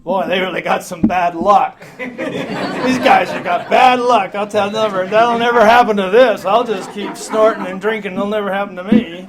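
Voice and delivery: loud, cocky denial voice; brash put-on drunk voice, laughing; mocking alcoholics. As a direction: boast it like a drunk who thinks he's immune. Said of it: "all the alcoholics, you can just pick 'em out".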